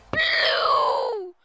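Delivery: "blue" stretched into a loud howl; hyped demanding cry. Real, not acted. Streamer begging the pack for blue, hyped cry. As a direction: beg loudly for it, dragging out the last word.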